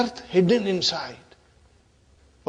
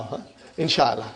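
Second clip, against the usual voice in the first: ordinary talk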